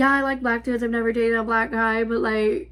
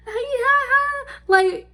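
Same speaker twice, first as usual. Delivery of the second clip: high nervous laughing; laughing it off in disbelief; 12 semitones higher than usual. Real, not acted. Laughing at the absurd FaceTime, high and nervous.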